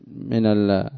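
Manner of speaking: ordinary talk, nothing marked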